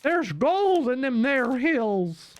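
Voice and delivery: high, drawn-out prospector voice; old prospector. Acted. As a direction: crow it slowly like a gold prospector. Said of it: "we need the prospector".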